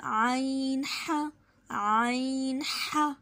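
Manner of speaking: आइन ह very slow, squeezed from mid throat; throat letter demo. Shown as a demonstration. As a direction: press आइन ह out of the middle of the throat. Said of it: "ये थोड़ा complicated है".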